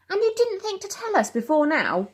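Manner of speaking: high-pitched raging mother; rigid with rage. Acted. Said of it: "Mum's body was rigid with rage".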